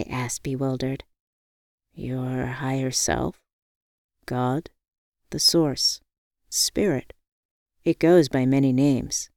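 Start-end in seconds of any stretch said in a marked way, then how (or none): 1.9-9.3 lightly lowered, calm man; a hint of a calm friend; calm Hendrik voice.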